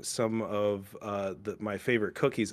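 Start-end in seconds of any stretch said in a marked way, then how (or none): none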